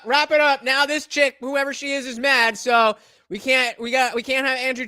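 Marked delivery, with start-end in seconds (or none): 0.0-0.6 loud, higher call to shut it down; mock announcer call. Telling the podcast to end it, a loud mock call. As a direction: declare the show over, hammed up.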